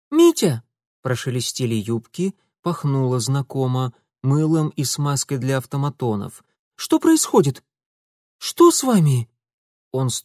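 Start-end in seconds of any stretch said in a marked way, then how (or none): 0.1-0.6 high, loud alarmed call; worried call. Acted. Zinaida calling. 6.7-9.3 high, loud worried questions; alarmed. Acted. Zinaida alarmed.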